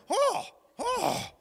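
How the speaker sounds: two loud, high-pitched excited "oh"s; excited gasps. Retold or imitated. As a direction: imitate his overexcited outbursts. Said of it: "he was really excited".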